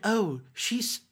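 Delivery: ordinary talk, nothing marked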